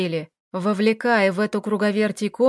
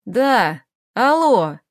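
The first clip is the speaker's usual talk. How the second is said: заспанная недовольная мать; 4 dB louder, much slower than usual. Acted. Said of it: "заспанный недовольный голос её мамаши буркнул".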